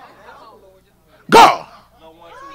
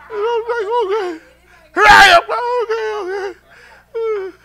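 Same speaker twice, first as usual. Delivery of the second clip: laughing and crying; 9 semitones higher, slower than usual. Real, not acted. Overcome at reunion.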